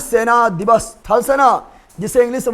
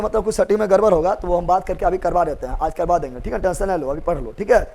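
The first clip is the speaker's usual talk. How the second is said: low, softer, quick aside; quiet reassurance; 4 semitones lower, 6 dB quieter, faster than usual. Real, not acted. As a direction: settle a student's worry in a lowered voice. Reassures student about technical problem. (धीमी आवाज़ में दिलासा)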